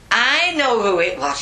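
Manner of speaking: high, loud, knowing nun; loud accusation. Retold or imitated. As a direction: pounce on the culprit, imitating a cross nun. Acts nun's loud accusation.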